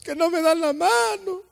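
high, slow, tearful whimper; mock crying complaint. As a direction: sob it out like a sulking child. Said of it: "Quién es aquel que sale del templo". (llanto fingido y agudo)

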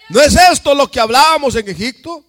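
predicando fuerte. Real, not acted.

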